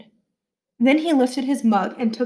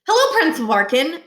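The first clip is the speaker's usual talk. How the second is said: cheerful greeting; 6 semitones higher, 4 dB louder than usual. A narrator's reading. Niles greets the new principal.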